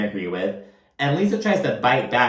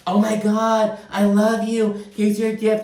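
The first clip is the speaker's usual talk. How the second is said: Lisa impression; 9 semitones higher than usual. [name] mimics Lisa greeting Heather with a gift, high mock gushing. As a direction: imitate a gushing friend in a raised voice. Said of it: "[name] imitated Lisa in, like, the exact same way".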